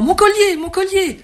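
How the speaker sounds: a robbed woman crying out for her necklace; panicked cry. A narrator's reading. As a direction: scream for the lost necklace. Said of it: "en criant".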